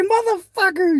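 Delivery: very high mock mama cry; imitating mama. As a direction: squeal it like an angry mom. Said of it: "It was funny how she said it".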